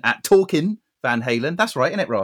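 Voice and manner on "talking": "Talking" said as talkin'; dropped final G. Shown as a demonstration. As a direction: end "Talking" on an n, no g sound. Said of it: "There's no G on Talking".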